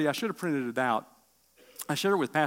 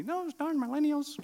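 high, scornful mock grumble; mock scolding; 12 semitones higher than usual. Preacher mocking the church's complaints about young people, in a high scornful voice. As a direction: sneer like a grumpy elder. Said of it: "so don't be".

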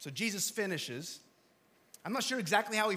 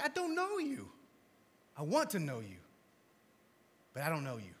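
weeping Jesus; 3 semitones lower than usual. Retold or imitated. Pastor voicing Jesus weeping over people he never knew, grieving. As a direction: say it like it breaks your heart. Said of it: "I think he's weeping".

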